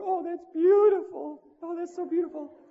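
mock Oprah gushing. Oprah gushing over the women, high and mock-tender. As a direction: coo it like a touched talk show host. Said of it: "And Oprah's going".

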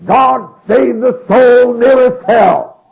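slow, high, full-volume preacher's scream; Oliver Green. Retold or imitated. Southern evangelist ending his radio broadcast, yelling and screaming. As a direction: yell each word long and loud. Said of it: "hear him yelling and screaming that".

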